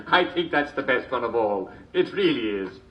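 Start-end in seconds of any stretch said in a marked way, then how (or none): none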